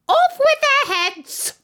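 very high, loud, drawn-out order; shouted command. A narrator's reading. Queen condemns the gardeners, shouting high. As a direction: shriek the order as a tyrant Queen.

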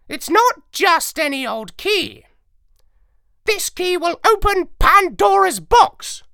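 high, loud, know-it-all parrot voice; lecturing parrot. A narrator's reading. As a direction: lecture like the brainiest bird. Parrot explains the key.

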